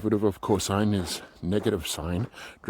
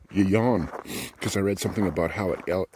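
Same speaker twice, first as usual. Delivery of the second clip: ordinary talk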